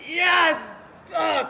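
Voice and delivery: high, loud yelp of joy; excited shout. Real, not acted. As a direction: cheer out loud. Backpack floats, excited shout.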